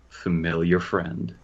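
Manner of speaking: ordinary talk, nothing marked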